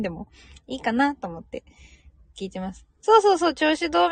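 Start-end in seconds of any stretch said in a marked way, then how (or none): 3.0-4.1 casual greeting. Acted.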